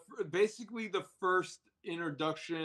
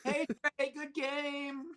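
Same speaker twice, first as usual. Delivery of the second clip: mock cheer; 10 semitones higher than usual.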